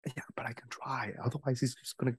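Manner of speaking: hushed, urgent back-and-forth; whispering. Acted. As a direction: whisper your case to a friend. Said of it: "You can hear us whispering".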